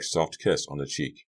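plain reading voice

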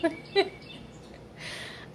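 high, breathy giggle; laugh. Real, not acted. Admitting she wanted to see the bear again, a laugh. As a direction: giggle at your own excitement.

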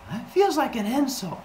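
high-pitched small protest; hurt humans. Acted. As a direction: object like a wounded child. Humans timidly object.

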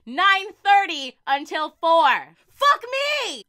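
slow, loud build into a high shout; drawn-out "nine thirty until four", then a shouted "Fuck me!"; exasperated yell. Real, not acted. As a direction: blow up at the absurd schedule. Punchline about work hours.

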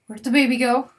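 loud, alarmed question; surprised. Real, not acted. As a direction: blurt out in sudden worry. Lost baby chicken.

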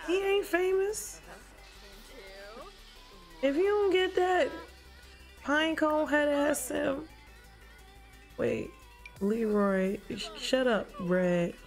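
normal talking voice

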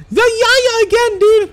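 loud, very high squeal; outraged disbelief. Real, not acted. Commentator sees the Yaya land again, loud exasperated squeal. Same move lands once more.

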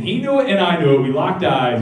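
ordinary talk

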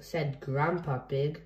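ordinary delivery

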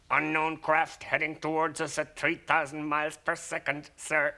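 crisp robot announcing a contact. A narrator's reading. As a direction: speak as a dutiful ship robot. Robot reports to his master.

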